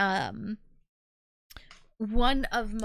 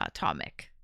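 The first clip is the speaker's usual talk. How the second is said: ordinary delivery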